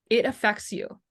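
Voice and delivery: usual speaking voice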